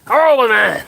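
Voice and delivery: loud, scornful exclamation; indignant outburst. Real, not acted. Condemning the Belarusian president, loud and scornful. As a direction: spit out the disgust.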